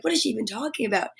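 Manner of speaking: quick, hushed puzzled aside; confused viewer voice. Retold or imitated. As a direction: mutter it like a lost newcomer. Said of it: "you're like".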